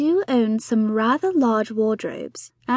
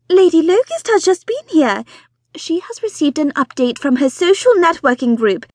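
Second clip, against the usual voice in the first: high, loud, breathless society wife; fussy excited mother; 5 semitones higher, 6 dB louder than usual. Acted. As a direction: gush it out like an eager matchmaking mother. Mrs Bonnet spreading gossip.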